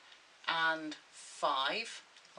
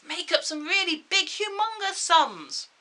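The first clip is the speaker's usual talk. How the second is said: sneering panto villain; 10 semitones higher, 7 dB louder than usual. Acted. Teacher dares pupils to try bigger sums.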